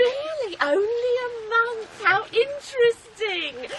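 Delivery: insinuating. Acted. Insinuating about quick remarriage.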